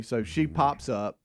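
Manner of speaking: normal talking voice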